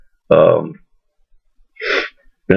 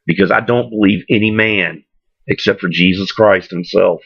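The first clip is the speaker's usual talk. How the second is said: ordinary talk, nothing marked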